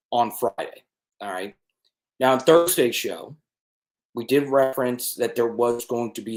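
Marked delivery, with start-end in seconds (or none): none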